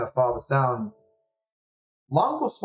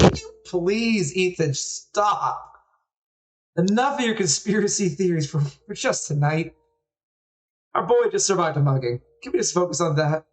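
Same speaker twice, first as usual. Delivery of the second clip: exasperated mom; 6 semitones higher than usual. Acted. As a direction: scold your husband like a fed-up mother. Mother scolds conspiracy-minded husband.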